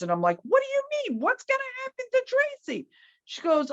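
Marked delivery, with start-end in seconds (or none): 0.4-2.9 high, alarmed squeak of disbelief; much higher, thin worried outcry. Retold or imitated. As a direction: panic rising in the voice at the question. Alarmed reply to caller.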